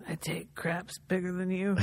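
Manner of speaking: low, hushed, whispery tough-guy line; quiet, menacing movie-cowboy imitation; Jack Palance. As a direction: rasp it softly, almost without voice. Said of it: "What does Jack Palance say?".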